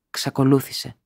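ordinary delivery, nothing marked

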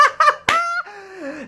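squealing high laugh. Real, not acted. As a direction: burst into high giggles. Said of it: "we start laughing".

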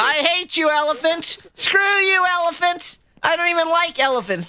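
very high, squealed mock tantrum; mock fury. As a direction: throw a squeaky pretend tantrum. Mock quarrel with game's elephant.

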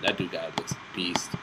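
soft unvoiced whisper of awe; whispering "That dude guy looks beast" under the breath. Real, not acted. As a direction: breathe it out, impressed. Admiring a champion.

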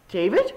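"David" rising at the end; question tune. Acted. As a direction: say "David" as if asking. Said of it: "said it like a question".

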